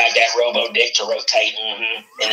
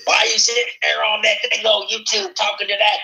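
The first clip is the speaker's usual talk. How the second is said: high-pitched scolding wife voice; nagging wife; 7 semitones higher than usual. Pretend. Friend plays Chris's wife scolding him, high nagging voice. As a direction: nag him in a raised pitch. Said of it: "your wife's gonna whip your ass".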